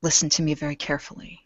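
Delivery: soft, hushed address; leaning in close. Acted. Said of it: "she will lean down and she'll say".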